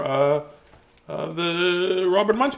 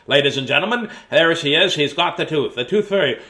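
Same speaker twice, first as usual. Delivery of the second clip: ordinary delivery, nothing marked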